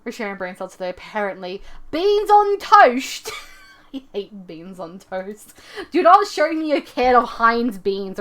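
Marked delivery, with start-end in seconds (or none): 1.9-3.3 high, loud, drawn-out cry; mock exclamation. Streamer calls out beans on toast, high drawn-out exclamation. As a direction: stretch it out slowly in a big high voice.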